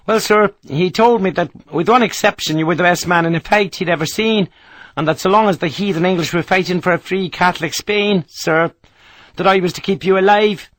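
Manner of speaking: the Irish sergeant explaining the major's orders; Irish soldier. Acted. Irish sergeant voiced by narrator.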